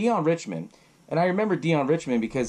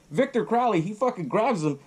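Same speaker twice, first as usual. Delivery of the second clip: ordinary talk, nothing marked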